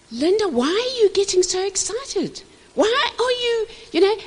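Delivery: high-pitched mock husband questioning his wife; imitating questioning husband. Husband asking wife why she is so excited, high imitation. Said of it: "Drummond always says to me".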